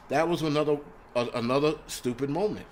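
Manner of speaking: normal talking voice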